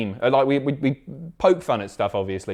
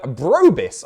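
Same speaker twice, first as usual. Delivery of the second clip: ordinary talk, nothing marked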